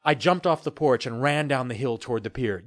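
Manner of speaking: plain reading voice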